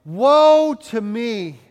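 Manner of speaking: loud, slow cry of woe. Acted. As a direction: drag out the woe in awe and dread. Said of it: "And I cried".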